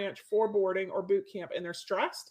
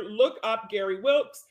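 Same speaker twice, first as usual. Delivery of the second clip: ordinary delivery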